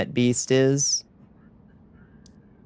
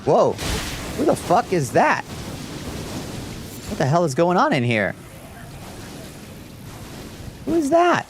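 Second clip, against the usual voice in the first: startled disbelief; 7 semitones higher, slower than usual. Real, not acted. Startled at strange player.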